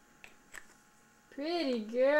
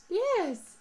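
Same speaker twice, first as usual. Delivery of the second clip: usual speaking voice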